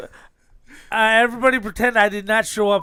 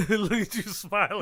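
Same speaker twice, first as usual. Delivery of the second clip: laughing; 5 dB quieter than usual. Real, not acted. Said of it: "You can hear the smile in your voice".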